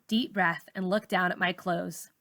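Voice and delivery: ordinary delivery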